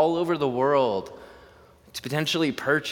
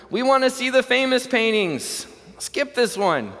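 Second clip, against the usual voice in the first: much higher, louder call from the back; impatient heckle; 12 semitones higher, 4 dB louder than usual. Acted. Bidder at the back calls out to the auctioneer, high and loud. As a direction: brush the picture off loudly.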